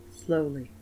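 ordinary talk

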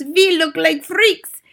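witch mimicking a shouting child; boy's shout. A narrator's reading. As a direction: wail it out in rhyme. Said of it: "Another boy shouts".

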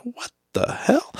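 high, slow, squeaky what-the-hell; stunned disbelief. Retold or imitated. Retelling a stranger yelling at a red light, high incredulous squeak. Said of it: "And I'm just like".

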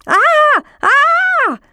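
two very high, loud shrieks; scream of alarm. A narrator's reading. Frogs nearly hit on the roundabout, screaming in alarm.

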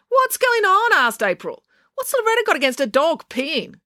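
yelling April. A narrator's reading. April calls from the porch to Loretta, haughty yell. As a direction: proclaim it from the porch.

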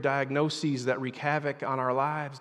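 ordinary talk

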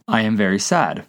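ordinary talk

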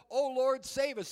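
loud chanting yell; crowd yell. Retold or imitated. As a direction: shout the festival plea. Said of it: "they just yelled".

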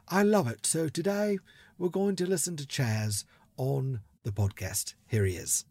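mock English announcer introducing a guest; playful English accent. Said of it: "bear with me with the whole accent thing".

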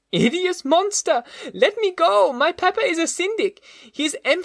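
put-on very high, shrill child's voice hurling insults; a frantic little boy screaming for release; terrified child. A narrator's reading. Boy fighting the monster's grip, screaming insults in high panic. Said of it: "he cried".

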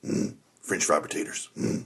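low, soft, breathy Carl grunt; quoting Carl softly and low; Carl from Sling Blade. Retold or imitated. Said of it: "that's all I remember him saying".

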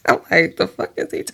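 breathless laughing whisper. Real, not acted. Breaking character, laughing at her own reading. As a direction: laugh it out under your breath. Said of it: "Oh, my goodness, y'all".

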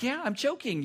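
high-pitched imitation of a choking child; mock choking girl. Daughter answering while choking, high-pitched mock distress. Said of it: "And she's like".

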